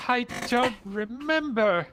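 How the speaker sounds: high, slow, drawn-out lament; anguished amnesiac. Acted. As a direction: wail it out word by word.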